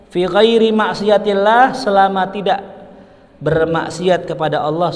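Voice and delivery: loud, high sermon delivery; full-force preaching. Acted. Preacher proclaims duties to parents, loud and fervent. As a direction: proclaim each rule to the hall.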